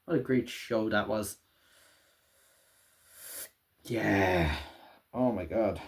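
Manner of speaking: soft, low, sighing and drained; wistful sigh. Real, not acted. Vlogger sighing to viewers over the show's ending, soft and low. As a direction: let it sink in, quietly. Sighing after the finale ends.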